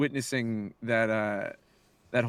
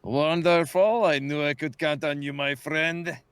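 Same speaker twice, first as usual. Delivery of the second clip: Durzik. Acted. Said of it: "he would say something like".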